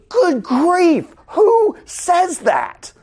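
teacher appalled by Job's friends' cruelty, incredulous and high; incredulous good grief. Real, not acted. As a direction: pitch shoots way up, slow, aghast.

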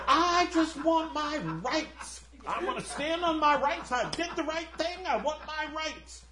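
whiny ghost. Retold or imitated. Ghost insisting on his rights in heaven, high and petulant. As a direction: demand your rights like a sulking child. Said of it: "he's like".

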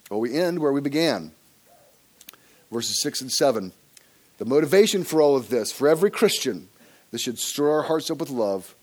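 usual speaking voice

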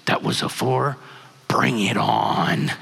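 low, drawn-out imitation of a wife's dare; mock fierce bravado. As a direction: stretch it slowly and low, newly emboldened. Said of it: "and her face lit up. She goes".

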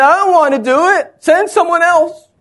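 high, loud mock protest; hammed-up pleading refusal; Moses refusing. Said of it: "Moses said".

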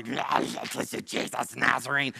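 slow, guttural demon growl; demonic voice. Shown as a demonstration. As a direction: growl it out like a monster. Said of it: "talking about the voice".

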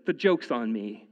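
normal talking voice

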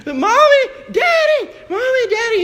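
put-on very high, loud toddler wail; piercing mock scream of a child waking alone; imitated child's scream. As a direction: mimic a child crying out for the parents. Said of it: "she's screaming, she's yelling".

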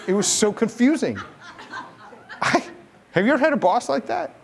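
usual speaking voice

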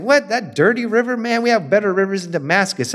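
high-pitched, offended protest; indignant scoff. Retold or imitated. Naaman refuses to bathe in the river, indignant scoff. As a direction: scoff at the dirty river like a proud general. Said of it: "And Naaman gets upset".

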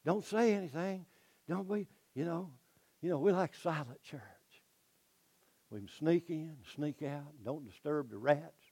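hushed, soft shushing, nearly a whisper; shushing Pharisees. Acted. Pharisees hushing the crowd, soft and hushed. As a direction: shush the crowd like nervous church elders. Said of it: "the Pharisees start saying".

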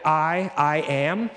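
slow, weighty "I, I am" with each word given full stress; emphatic I am. Shown as a demonstration. Preacher explaining the Greek to his congregation, slow emphatic stress. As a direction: lean on "I am" slowly as the divine name. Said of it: "which is an emphatic".

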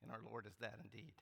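soft, quick words, choked with real feeling. Real, not acted. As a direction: let emotion catch in the throat, softly. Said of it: "Sorry, I'm getting emotional already".

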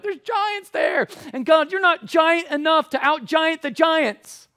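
high, loud wail of protest; mock panic. Terrified Israelites protesting to God about the giants, mock panic.

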